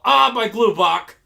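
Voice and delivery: loud, high mock yell of pain; Piccolo. Re-enacting the beaten fighter's yell, loud hammed-up agony. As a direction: cry out like a cartoon fighter just hit. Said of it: "Piccolo does yell".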